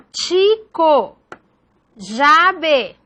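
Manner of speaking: "Chico, llave" one syllable at a time; "Chico, llave" with each syllable set apart; syllable division. Shown as a demonstration. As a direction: say chi-co, lla-ve for "Chico, llave". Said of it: "que no se separan".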